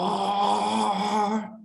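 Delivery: big, high-pitched bellowed summons; ancient bellow. A narrator's reading. Ancient slann master bellowing for Sigmar. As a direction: call his name like an ancient lord. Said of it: "in a deep, ancient voice, he bellowed".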